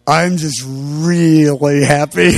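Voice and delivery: slow, low, blissed-out drawl; imitating a happy daze, not giddy; retold happiness. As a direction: draw out "really" long, contentedly. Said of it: "And I don't mean giddy".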